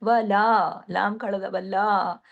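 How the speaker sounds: ला stretched for one alif's length. Shown as a demonstration. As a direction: hold the aa at the end of वला. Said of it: "अलिफ के बराबर लाम खींचेगा". (वला में लाम को अलिफ के बराबर खींचें)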